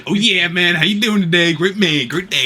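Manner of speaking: high, loud, cheery bro voice; mock Sam cheer. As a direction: pump it up like a hyped coworker. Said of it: "what he says".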